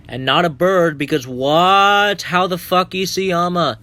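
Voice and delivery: exasperated rant at the author, stretching the key word; incredulous outburst. Real, not acted. Mocking the original bird ending.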